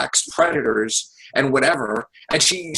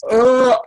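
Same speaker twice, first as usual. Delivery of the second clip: a high, loud mocking "uh" of a sob; 8 semitones higher, 6 dB louder than usual. Mocking crying voters, a hammed-up sob. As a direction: imitate upset voters bawling. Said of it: "uh, crying".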